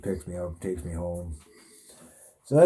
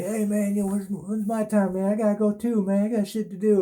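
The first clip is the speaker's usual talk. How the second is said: much higher, nagging inmate voice; whiny inmates; 11 semitones higher, 4 dB louder than usual. Retold or imitated. Inmates pestering to be let out too, high whiny voice. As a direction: whine like a jailbird who wants out. Other inmates whining to leave.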